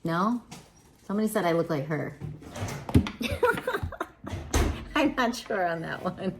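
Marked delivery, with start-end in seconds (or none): 2.2-4.9 breathy high unvoiced laugh; airy laugh. Real, not acted. Streamer laughing at a lookalike claim, airy laugh. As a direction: laugh it off, a bit unsure.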